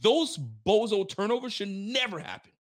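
ordinary talk, nothing marked

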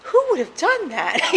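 high, giggly fake innocence; mock innocent, laughing. Daughter pretending to the stepfather she knows nothing, laughing. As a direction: play dumb while barely holding back a laugh.

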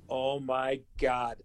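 low, hushed and drawn out; slow, quiet dawning realization; stunned disbelief. Retold or imitated. Player to himself at the stadium gate, stunned.

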